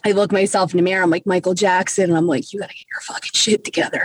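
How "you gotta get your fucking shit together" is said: hushed, quick whisper to yourself; urgent under-the-breath self-scolding; whispered self-talk. Retold or imitated. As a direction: whisper a retold pep talk into the mirror. Talking to self in mirror.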